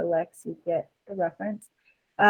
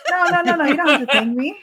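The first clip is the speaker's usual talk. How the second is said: put-on wholesome 1950s kid; 8 semitones higher, 6 dB louder, much faster than usual. Con artist voices a character.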